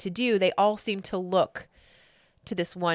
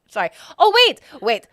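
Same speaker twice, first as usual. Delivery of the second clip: ordinary talk, nothing marked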